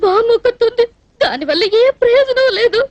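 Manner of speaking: sobbing through the words; crying. Acted. Orphaned girl telling him she has nobody, crying. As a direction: weep out a helpless lament. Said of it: "ఎందుకు ఏడుస్తున్నట్టు ఇప్పుడు నువ్వు".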